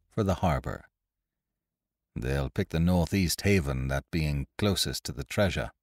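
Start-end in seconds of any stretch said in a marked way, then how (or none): 2.2-5.7 cool, unhurried and softer; coolly. A narrator's reading. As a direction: say it calmly, unbothered. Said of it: "said Ned Low coolly".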